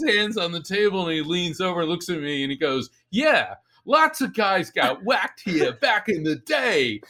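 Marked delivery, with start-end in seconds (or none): 3.1-7.0 slow, higher-pitched mobster caricature; big Italian tough guy. Retold or imitated. Big Italian guy answering the psychic, slow wiseguy voice. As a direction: draw it out like a casual wiseguy. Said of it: "they're all these big Italian guys".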